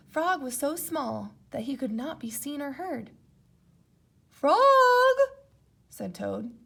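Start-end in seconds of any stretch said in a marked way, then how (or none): 4.3-5.2 high, loud worried call. Acted. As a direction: call out for Frog. Toad calling.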